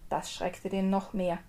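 usual speaking voice

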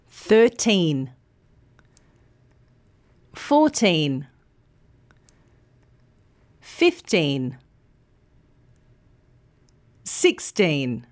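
put-on Thirteen, fourteen, fifteen, sixteen with teen long and clear; teen stressed. Shown as a demonstration. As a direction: lean on the teen each time. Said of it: "The second syllable, teen, is long and clear".

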